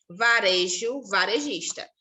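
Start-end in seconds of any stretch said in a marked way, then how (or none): none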